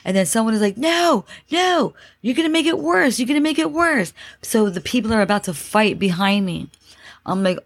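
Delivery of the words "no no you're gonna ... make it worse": high, urgent mock shout; retold panicked protest. Passenger to person prying the doors, imitated panicked protest. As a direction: imitate someone frantically begging others to stop. Retold quarrel in stuck elevator.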